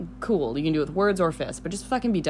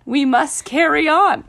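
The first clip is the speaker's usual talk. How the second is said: high, loud, slow pep-talk voice; rally them like an epic leader; mock motivational speech; 10 semitones higher, 7 dB louder, slower than usual.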